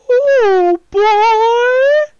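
very high, loud creepy manager wail; Paul Bearer. Pretend. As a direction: screech it like a spooky wrestling manager. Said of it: "a bit of a pallbearer".

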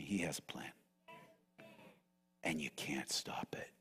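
gentle guided prayer voice with whispered words; hushed pastoral meditation. Acted. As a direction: ease the church into rest, almost whispering. Quiet reflective call to rest.

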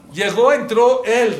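slow, loud and high, grabbing attention; sudden loud burst. Acted. Sermon to congregation, a loud slow burst. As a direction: belt the opening line out slowly.

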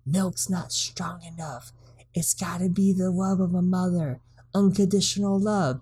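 put-on high, hushed old woman's secret; old lady whisper. Old lady Hackmore confiding to the mayor, hushed high. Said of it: "she's like".

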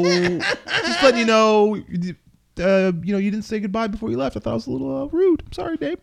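very high, apologetic mock-kid voice; awkward nerdy kid. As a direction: imitate a timid kid, pitch climbing into the apology. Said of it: "most awkward, nerdiest voice ever".

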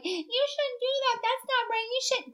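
high, slow, soft mock scolding voice; mimicking sanctimonious hypocrites; mocking preachy people. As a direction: sneer it out like a prissy scold. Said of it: "these are the same fucking people that are like".

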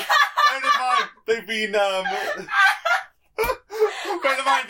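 helpless high laughter breaking into the words; loud, high, laughing through it. Real, not acted. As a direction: try to explain while cracking up. GM cracks up while explaining.

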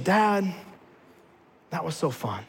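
put-on a child's hushed awe after the ride; hushed, awed daughter. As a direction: say it softly, in quiet wonder. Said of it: "she looked at me and she said".

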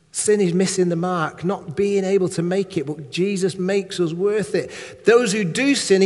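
normal talking voice

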